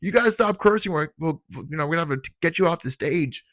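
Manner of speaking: a mock stern teacher telling kids off; scolding teacher. Teachers threaten to pull him offstage, mock scolding. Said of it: "And they go".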